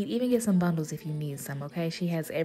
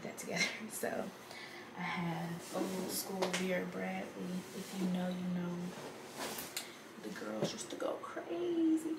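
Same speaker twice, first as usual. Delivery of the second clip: barely audible; 10 dB quieter than usual. Real, not acted. Keeps voice low late at night.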